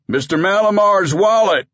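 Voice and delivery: high, loud triumphant call; deputy calls out to everyone, excited shout. Acted. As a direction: shout it out holding up the find. Deputy emerges waving found wallet.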